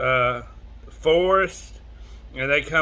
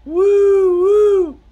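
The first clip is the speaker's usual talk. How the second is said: high, loud train-like "woo-woo"; two sustained hoots, high and loud; answering hoot; 9 dB louder than usual. Acted. Sound answering the cowboy from the cave, high loud hoot. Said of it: "he hears a".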